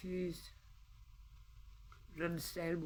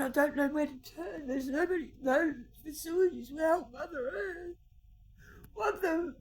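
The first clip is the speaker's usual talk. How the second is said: much higher and louder, tearful cries; crying shout; 7 semitones higher, 13 dB louder than usual. Real, not acted. Pleading for Mother Earth to be heard, shouting through tears. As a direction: cry out in despair to be heard.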